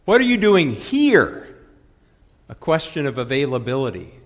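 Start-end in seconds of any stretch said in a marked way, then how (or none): 0.1-1.6 HERE hit high and loud at the end; stress on HERE. Shown as a demonstration. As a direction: punch the last word, here. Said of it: "depending on where you put the emphasis".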